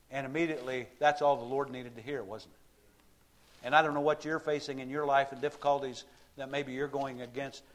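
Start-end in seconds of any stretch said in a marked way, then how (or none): none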